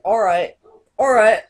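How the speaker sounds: imitating how the English say hello; mock British greeting. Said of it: "what they say there is like".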